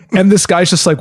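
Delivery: ordinary talk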